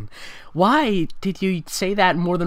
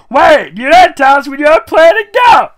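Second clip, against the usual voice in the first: much higher and louder excited squeal; high-pitched, high-energy Pinkie shout; 13 semitones higher, 14 dB louder than usual. Acted. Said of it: "high-pitched, high-energy voice that she talks in".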